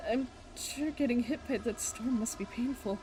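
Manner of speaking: ordinary talk, nothing marked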